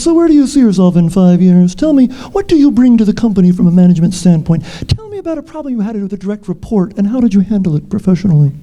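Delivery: lightly voiced formal interviewer, a different timbre; job interviewer. Scammers interviewing the job seeker, a lightly put-on interviewer voice. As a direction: play the scammer interviewer grilling her with stock questions. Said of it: "they asked all the right interview questions".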